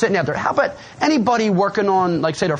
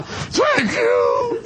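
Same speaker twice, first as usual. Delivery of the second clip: tearful cry. Retold or imitated. Crying newcomer thanking the speaker after his talk. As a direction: cry it out, overwhelmed. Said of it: "and I'm crying".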